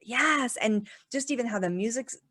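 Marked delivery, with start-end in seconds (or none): none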